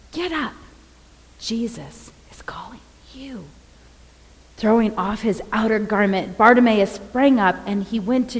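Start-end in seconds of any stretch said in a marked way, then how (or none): 0.1-3.5 hushed urging. Retold or imitated. Crowd telling blind Bartimaeus Jesus calls him, hushed urging. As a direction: urge softly, full of wonder.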